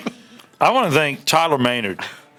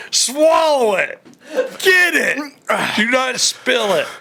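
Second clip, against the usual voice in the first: laughing urging; 10 semitones higher, 3 dB louder, slower than usual. Real, not acted.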